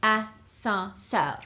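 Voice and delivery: Ascenseur slow with a settling fall; Ascenseur, clear three-syllable model; slow French model. Shown as a demonstration. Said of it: "three syllables".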